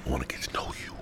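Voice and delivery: breathy intimate whisper. Acted. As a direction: lean in and whisper it close. Whispered podcast intro line.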